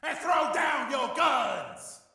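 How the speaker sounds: slow, high-pitched yell; screamed order. Acted. Terrorist demands the boys disarm, screaming. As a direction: draw out the screamed command. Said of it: "he screamed".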